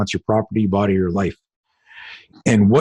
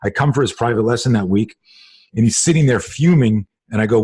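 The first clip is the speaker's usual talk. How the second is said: ordinary delivery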